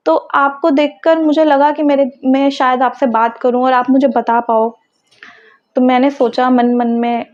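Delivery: ordinary talk, nothing marked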